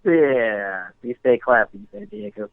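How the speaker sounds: low, lightly voiced anchorman sign-off; a hint of the smug movie anchorman; Ron Burgundy quote. Anchor answers the Ron Burgundy joke with a lightly voiced sign-off. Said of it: "If this isn't Ron Burgundy".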